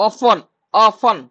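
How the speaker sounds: ଅଫନ୍ drawn out slowly with the t left silent; saying often slowly. Shown as a demonstration. Teacher showing the class how to say often, slowly. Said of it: "ଅଫନ୍ ଲ".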